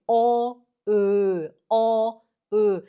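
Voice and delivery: loud, deliberate "ó, ö, ó, ö"; vowel contrast drill. Shown as a demonstration. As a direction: alternate "ó" and "ö", each held and separate. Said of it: "fala assim".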